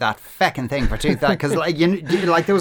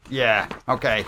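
usual speaking voice

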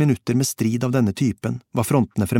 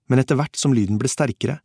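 plain reading voice

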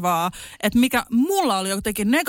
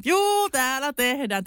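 slow, higher friendly answer; cheery reply; 5 semitones higher, slower than usual. Retold or imitated. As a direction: answer back brightly and slowly. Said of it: "Mä oon". (hidas, pirteä vastaus)

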